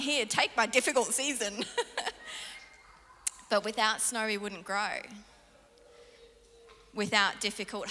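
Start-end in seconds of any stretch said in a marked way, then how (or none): 0.0-1.7 high, laughing mock offer. Offering the pastor her hard season, laughing. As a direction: toss it off with a laugh. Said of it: "I'm like".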